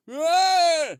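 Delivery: high, loud imitation of a strange yelp; a drunk heckler's sudden yelp, imitated; weird yelp. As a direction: squawk it out from the balcony. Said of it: "It's just like a weird sound".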